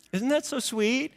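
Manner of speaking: high, soft, touched coo. Real, not acted. Preacher to congregation after the love note, cooing. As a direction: coo it sweetly, lifting high at the end.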